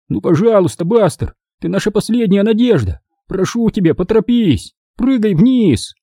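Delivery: high, urgent plea; pleading. A narrator's reading. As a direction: beg the dog as a last hope, voice lifted. Begging the dog to jump. (умоляет собаку)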